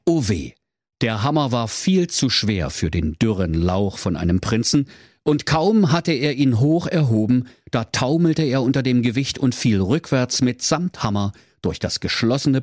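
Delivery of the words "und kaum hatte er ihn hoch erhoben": ordinary delivery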